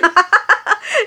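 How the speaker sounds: high giggling laugh. Acted. Maid to her mistress about the absent husband, laughing. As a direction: let out a high little laugh.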